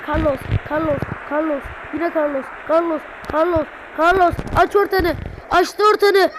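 loud, building yells at the screen; frustrated yelling. Real, not acted. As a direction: shout each name harder. Repeated frustrated yelling at game.

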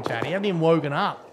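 ordinary talk, nothing marked

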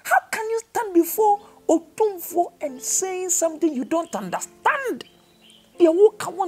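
high, ringing pulpit protest; impassioned preaching. Real, not acted. Preaches with rising force.

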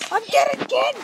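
high, loud frustrated yelp; gamer's high exasperated yelp; exasperated cry. Real, not acted. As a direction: yelp at dying again. Dying in game.

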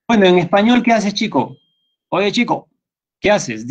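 high-pitched mock voice of a fussy corrector. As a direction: squeak it out like a know-it-all nitpicker. Mocking a pedantic corrector.

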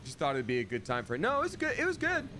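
a lightly voiced bashful buddy; high companion voice. Voicing companion Pard dialogue.

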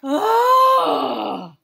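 high, loud wailing oh; anguished cry. Acted. Gerald despairs after long wait, high loud wail.

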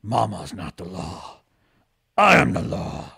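low, grizzled lawman growl; gruff Judge Dredd impression. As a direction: growl it like a helmeted hard-boiled judge. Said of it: "he's just grizzled and he's just like".

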